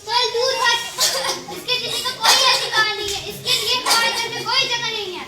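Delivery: loud, harsh shout; furious shout. Acted. Mother-in-law shouts down the pleading mother, furious shout. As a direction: drive her away in a rage.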